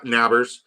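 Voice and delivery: "Nabbers" with a short a as in "nab"; imitated mispronunciation, "Nabbers" with a flat-out short a; mispronounced Neighbors. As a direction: say "Nabbers" to rhyme with "grabbers". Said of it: "he's intentionally mispronouncing it".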